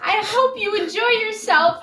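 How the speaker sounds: high, loud mock old-person voice; elderly person impression. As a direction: send them off like a doting elder, hammed up. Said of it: "this elderly couple goes".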